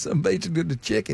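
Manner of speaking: lightly imitated garbled Southern talk. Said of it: "That's like Boomhauer".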